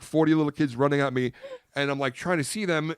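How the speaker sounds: ordinary delivery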